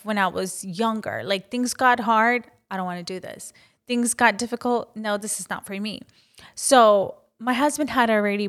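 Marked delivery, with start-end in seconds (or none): 2.7-3.5 soft, deflated mock whine, much softer; quiet giving up. Recalling her younger self quitting, soft and deflated. 5.0-6.0 softer, quick dismissive brush-off; quiet refusal. Retold or imitated. Recalling her younger self quitting, quick and soft. Retold giving up when younger.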